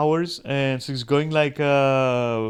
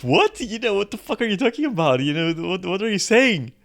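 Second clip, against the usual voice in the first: mock disbelief, higher and louder, fast; incredulous; 7 semitones higher, 4 dB louder than usual. Streamer baffled by the fan's flirting, incredulous. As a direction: react like it makes no sense at all. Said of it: "And I'm like".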